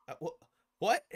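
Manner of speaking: high, stammered disbelief; retold baffled reaction. Host re-enacting his reply to opponent's logic, baffled. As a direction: stumble into an incredulous question. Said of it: "And I was like".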